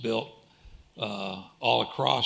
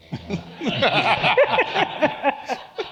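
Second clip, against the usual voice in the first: loud, high burst of laughter; 6 dB louder than usual. Real, not acted. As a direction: burst out laughing after a quip. Panelist laughs after own quip.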